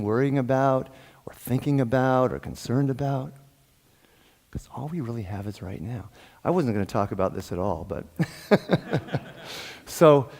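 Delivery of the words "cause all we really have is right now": soft breathy whisper of a secret. Real, not acted. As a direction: lean in and whisper it. Intimate aside to the congregation.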